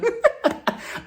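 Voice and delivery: breathless high laugh; high airy laugh. Real, not acted. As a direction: burst out laughing, almost silent. Laughing at the reunion moment.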